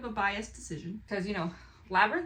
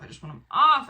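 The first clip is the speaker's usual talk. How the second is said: louder, exasperated retold plea; frustrated outburst; 7 semitones higher, 12 dB louder than usual. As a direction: let the frustration burst out. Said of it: "And I was like".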